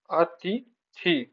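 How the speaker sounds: अतिथि drawn out slowly, each syllable apart; अतिथि, very slow and deliberate; slow careful spelling-out. Shown as a demonstration. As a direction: stretch अतिथि slowly to show its vowels. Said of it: "थी में बड़ी ई की मात्रा है".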